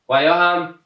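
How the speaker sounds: ordinary talk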